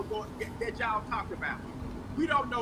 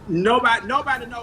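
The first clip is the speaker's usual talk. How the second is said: loud, forceful exclamation; loud shout; 11 dB louder than usual. Real, not acted. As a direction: cut in loudly, cry it out. Guest shouting in agreement.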